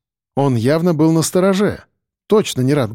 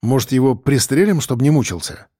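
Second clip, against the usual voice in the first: ordinary delivery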